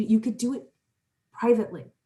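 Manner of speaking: quiet and calm, almost breathing it out; soft calming guidance. Shown as a demonstration. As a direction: ease the listener into stillness. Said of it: "put your hand to your heart".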